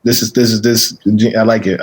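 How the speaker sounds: normal talking voice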